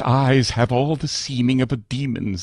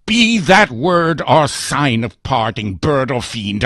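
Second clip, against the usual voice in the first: louder, higher furious outburst; shrieked; 4 semitones higher, 6 dB louder than usual. Acted. Narrator leaps up and orders the raven out, loud and enraged. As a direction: drive the bird away in fury. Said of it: "I shrieked upstarting".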